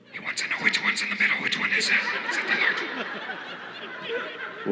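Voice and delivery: unvoiced whisper to a coworker; whispered aside. Worker asks coworker off-mic, whispered. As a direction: hide the question from the customer.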